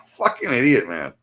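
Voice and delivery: ordinary talk, nothing marked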